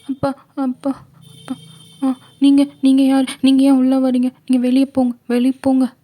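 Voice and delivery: put-on high, loud frightened girl's cry; scared girl shouting. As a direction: yell at the intruder in panic, high and loud. Frightened girl orders stranger out. (பயந்து கத்தும் சிறுமி)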